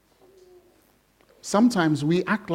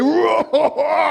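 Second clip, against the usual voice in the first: Hulk roar; 7 semitones higher than usual. Retold or imitated. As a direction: burst out like the Hulk. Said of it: "he's like".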